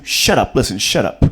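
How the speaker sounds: low, loud, slow stern father; deep deadpan movie dad. Acted. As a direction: lay down the hard news like a grim dad. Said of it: "Your dad tells you".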